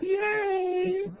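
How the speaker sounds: a very high, loud yay; excited cheer. Real, not acted. Co-commentator cheering the win, a high excited yay. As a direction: squeal it out in delight.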